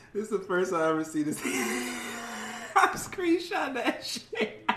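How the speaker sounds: high, laughing through the words. Real, not acted. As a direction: crack up while talking. Laughing at a screenshot.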